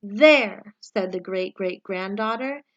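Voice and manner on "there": usual speaking voice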